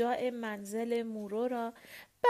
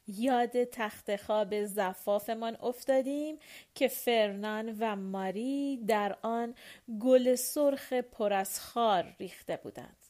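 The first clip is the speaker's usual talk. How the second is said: plain reading voice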